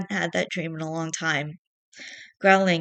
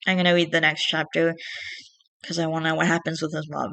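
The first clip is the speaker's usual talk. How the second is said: plain reading voice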